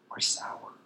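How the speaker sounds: dropped into a whisper for the joke; mock whisper. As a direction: whisper it like a secret aside. Punchline about father's moods.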